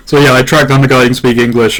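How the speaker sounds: ordinary talk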